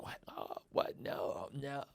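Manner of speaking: unvoiced, breathy mock alarm; a hint of a shocked girlfriend; startled refusal. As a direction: play her caught off guard, backing away.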